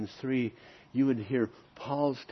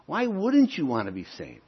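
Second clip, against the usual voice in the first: ordinary talk, nothing marked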